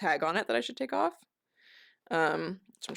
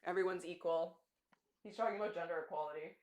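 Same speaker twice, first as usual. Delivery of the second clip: ordinary talk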